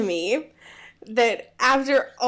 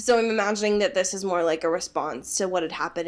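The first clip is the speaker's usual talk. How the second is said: ordinary delivery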